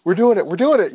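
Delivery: breathless excitement as the truck nears the flames; thrilled rookie. Retold or imitated. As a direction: relive the thrill of the first fire. First real fire, excitement.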